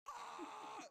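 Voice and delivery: very high, thin scream; mock scream. Crowd below hit by pepper, mock screaming. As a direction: let out a small far-away shriek. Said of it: "the people are screaming".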